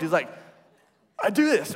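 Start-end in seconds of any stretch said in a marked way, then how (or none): 1.2-1.7 put-on squeaky high, loud boast like a kid showing off; way more excited than he should be; overexcited. Rich young ruler boasting to Jesus, pumped and high-pitched. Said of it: "he's just really pumped".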